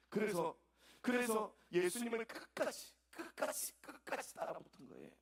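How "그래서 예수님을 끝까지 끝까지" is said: rising higher, slowly; building emphasis. Real, not acted. Preacher building up on 끝까지, higher each time.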